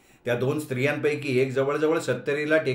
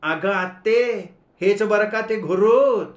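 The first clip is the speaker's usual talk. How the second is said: raised voice; 7 semitones higher, 5 dB louder, slower than usual. Acted. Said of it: "एकदम आवाज चढवत ... ओरडली".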